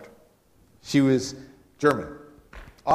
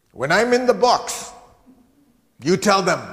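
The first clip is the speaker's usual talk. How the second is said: put-on high, loud German-accented woman; thick German accent; 7 semitones higher, 7 dB louder than usual. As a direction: lay down instructions in a spunky German accent. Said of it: "She had a very thick German accent".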